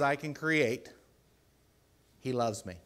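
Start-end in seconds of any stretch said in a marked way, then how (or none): none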